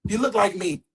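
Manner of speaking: acted-out exasperated dad; mock scolding dad. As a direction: order a pouting son to straighten up. Father scolds pouting son.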